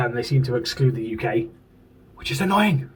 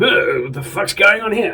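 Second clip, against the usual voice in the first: higher-pitched mock posh accent; 7 semitones higher, 3 dB louder than usual. Toy fan voices Megatron waking up scribbled on, mock posh accent. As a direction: put on a posh, baffled voice. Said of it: "he's got a posh accent".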